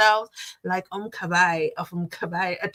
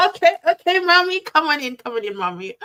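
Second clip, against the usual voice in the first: higher and louder, gushing welcome; excited welcome; 7 semitones higher, 6 dB louder than usual. Real, not acted. Vlogger greets a viewer who calls herself a mom, delighted. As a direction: throw the door open for her.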